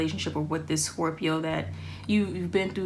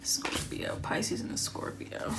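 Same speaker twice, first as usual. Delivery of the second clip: whisper; 7 dB quieter, slower than usual. Real, not acted. Muttering card reading to herself.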